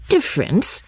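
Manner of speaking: high-pitched questioning interjection; suddenly alert cry. A narrator's reading. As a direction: pipe up, suddenly paying attention. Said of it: "cried Missus Honeychurch, suddenly alert".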